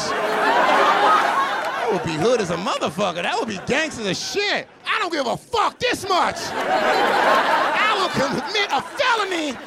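high, drawn-out shouted bravado; mock gangster yell. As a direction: yell it like a hammed-up maniac threat. Acting out a crazy robbery threat.

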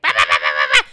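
very low, loud blah-blah yapping; mock her endless yapping like a dog. Host mocks Candace talking over Mia, low loud yapping. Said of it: "yapping her mouth like a fucking chihuahua".

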